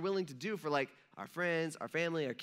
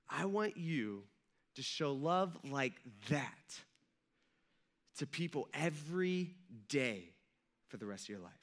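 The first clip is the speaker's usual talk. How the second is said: gentle charge; 3 semitones lower, 4 dB quieter than usual. Pretend. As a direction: lay it down tenderly, word by word. Jesus gives the closing charge.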